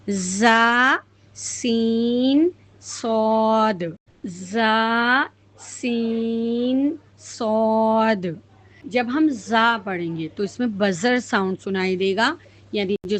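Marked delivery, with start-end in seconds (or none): 0.1-3.8 زا, سین, صاد drawn out slowly with hissing; Arabic letters demo. Shown as a demonstration. Teacher shows students the three whistling letters, stretched slowly. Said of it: "تو یہ تین حرف ہے". 4.2-8.3 زا, سین, صاد drawn out slowly with hissing; Arabic letters demo. Shown as a demonstration. Teacher shows students the three whistling letters, stretched slowly. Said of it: "تو یہ تین حرف ہے".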